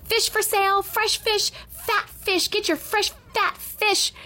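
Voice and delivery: high fishmonger's street cry; sing-out market cry without real volume; mock screaming. A narrator's reading. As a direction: hawk it in a high, sustained call. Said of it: "screaming anything that came to her head".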